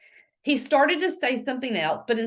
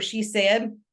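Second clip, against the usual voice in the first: usual speaking voice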